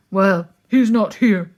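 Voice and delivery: loud, gruff grumble; grumbling bear. A narrator's reading. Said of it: "grumbled the bear".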